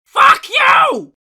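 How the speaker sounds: high, loud screeching bird shout; squawking raven. Acted. The raven squawks back at Shadow, screeching shout. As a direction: shriek it like an angry raven. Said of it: "said the raven".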